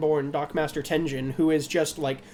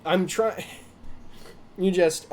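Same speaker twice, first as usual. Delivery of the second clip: normal talking voice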